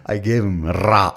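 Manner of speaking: slow "ra" with a heavy rolled R. Retold or imitated. Mocking Indians abroad, "ra" with an exaggerated rolled R. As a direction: roll the R hard on "ra". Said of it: "they roll the R's".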